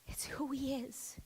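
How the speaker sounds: whispered It's who he is. Shown as a demonstration. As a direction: drop to a bare whisper.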